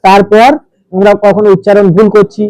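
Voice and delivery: ordinary delivery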